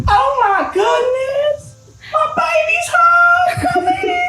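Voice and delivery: very high, slow, crying-out shriek of joy; overjoyed mom. Acted. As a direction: squeal like a mother seeing her baby home. Mother greets returning daughter.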